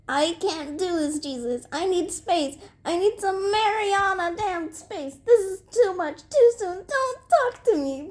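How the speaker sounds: high, tearful-sounding outburst; overwrought, high-pitched meltdown; upset yell. A narrator's reading. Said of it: "Beezus yelled".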